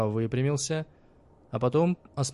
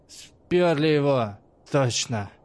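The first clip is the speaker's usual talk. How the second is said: slow, loud slurred insistence; drunk insistence; 3 semitones higher, 4 dB louder, slower than usual. A narrator's reading. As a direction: insist drunkenly, dragging words.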